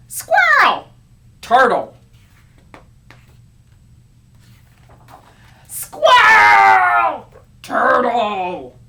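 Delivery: loud, high yelled names; shouting match. Acted. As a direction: bellow each word back.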